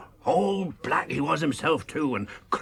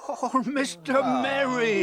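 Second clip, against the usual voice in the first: higher, slow, relieved cry; surprised relief; 12 semitones higher, 3 dB louder, slower than usual. Real, not acted. Maggot relieved the rider in the mist is Merry. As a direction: recognize a friend with relief.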